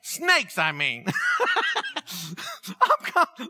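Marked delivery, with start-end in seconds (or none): 1.1-2.6 a sudden high laugh at himself; high burst of laughter. Real, not acted. Laughing at own confession.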